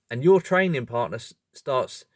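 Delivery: ordinary delivery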